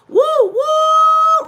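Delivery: high, loud cheering woo; excited whoop. Real, not acted. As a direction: whoop with joy at a viewer. Greeting viewers in live chat.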